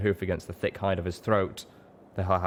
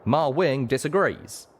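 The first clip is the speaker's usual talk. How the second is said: higher, louder, slow twangy retort; wry drawl; 7 semitones higher, 5 dB louder, slower than usual. A narrator's reading. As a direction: talk back with a grin. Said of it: "Calamity wiggled his wounded wing".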